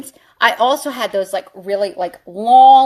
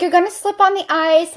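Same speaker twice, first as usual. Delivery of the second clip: lightly imitated higher mom voice; a hint of a warning mother; nagging mother; 7 semitones higher than usual. Mother warning daughter about ice, lightly mimicked nagging. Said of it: "She's like".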